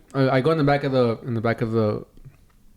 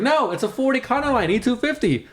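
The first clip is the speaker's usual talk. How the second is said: confused correction; 10 semitones higher than usual. Retold or imitated.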